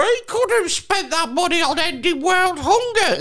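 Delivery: high whiny mockery; mock the complainers in a high whine. Mocking people's complaint.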